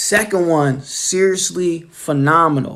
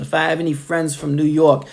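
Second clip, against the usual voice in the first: ordinary talk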